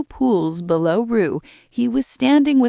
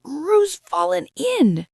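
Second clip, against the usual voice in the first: much higher, slow alarmed cry; 11 semitones higher, slower than usual. A narrator's reading. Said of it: "cried Rabbit".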